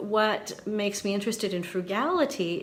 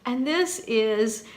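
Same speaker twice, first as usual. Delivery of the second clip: ordinary talk, nothing marked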